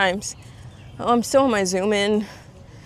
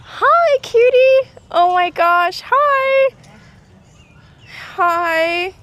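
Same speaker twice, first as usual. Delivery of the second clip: cooing greeting; 13 semitones higher, 6 dB louder, much slower than usual. Real, not acted. Vlogger saying hi to an ostrich, high cooing baby talk. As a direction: greet a cute animal in a high, gushing coo.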